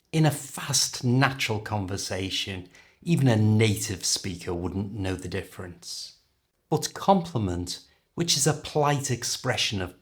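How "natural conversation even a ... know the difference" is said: ordinary talk, nothing marked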